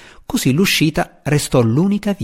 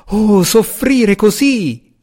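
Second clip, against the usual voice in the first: high, very slow theatrical wail; wailing moan; 9 semitones higher, 3 dB louder, much slower than usual. A narrator's reading. Wild-haired fanatic laments leaving the tunnel, slow high wail. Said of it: "gemette il fanatico".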